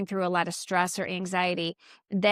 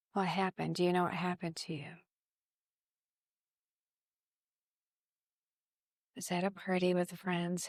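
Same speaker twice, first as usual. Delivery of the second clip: much softer, subdued voicing of a lost, sad young girl; relaying a lost girl; 5 dB quieter than usual. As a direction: pass on her words quietly, lost and sad. Medium relays the dead girl's words.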